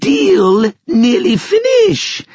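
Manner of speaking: very high, slow, loud creature squeal; shrill, drawn-out alien speech; Finn McCool's creature voice. A narrator's reading. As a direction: stretch it out in a piercing high creature voice.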